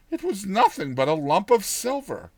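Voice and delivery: ordinary delivery, nothing marked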